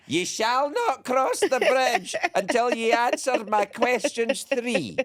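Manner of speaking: high, slow, booming troll challenge; bridge troll. Pretend. Comedian plays a Scottish bridge troll quizzing the host, high and slow. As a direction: bellow the riddle like a storybook troll guarding a bridge. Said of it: "I've met real trolls".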